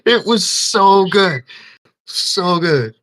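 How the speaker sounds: high, slow, laughing praise; giddy laughing; laughing delight. Real, not acted. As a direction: gush through laughter. Laughing after story.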